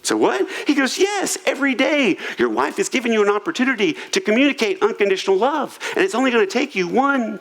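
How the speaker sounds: sincere counselor. Retold or imitated. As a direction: deliver it with no sarcasm at all, upbeat. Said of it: "with not an ounce of sarcasm".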